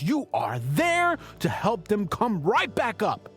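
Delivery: normal talking voice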